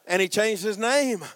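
ordinary talk

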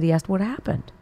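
ordinary talk, nothing marked

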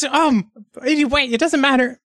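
high-pitched kobold. Acted. Kobold giving its name to adventurers, high voice. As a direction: introduce yourself high as a kobold.

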